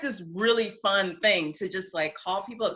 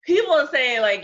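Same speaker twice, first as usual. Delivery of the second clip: ordinary talk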